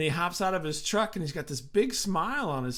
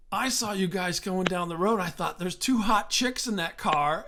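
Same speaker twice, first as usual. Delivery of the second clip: excited friend; 5 semitones higher than usual. Retold or imitated. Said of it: "And he goes".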